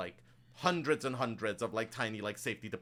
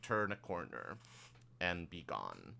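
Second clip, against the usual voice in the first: ordinary talk, nothing marked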